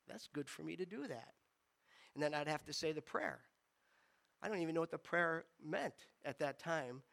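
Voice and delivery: usual speaking voice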